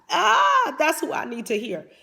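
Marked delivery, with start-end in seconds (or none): 0.1-0.7 excited cry. Real, not acted. Worked-up preaching cry.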